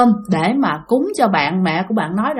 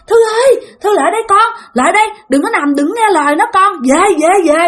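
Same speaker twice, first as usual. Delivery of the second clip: urgent call; 10 semitones higher than usual. Retold or imitated. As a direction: wave her over and shout. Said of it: "Cái ổng cứ quát tay".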